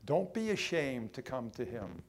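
ordinary talk, nothing marked

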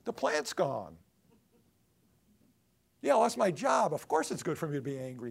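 loud, sulky complaint to God; sulking prophet insisting he is right; defiant Jonah. Retold or imitated. Voicing angry Jonah.

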